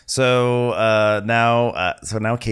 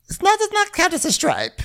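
shrieky-high mock retort; Faye impression voice; 3 dB louder than usual. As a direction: object sky-high as Faye. Faye's acted retort.

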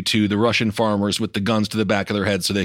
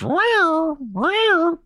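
cat tornado siren. Pretend. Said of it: "that's my impression of a cat doing a tornado warning".

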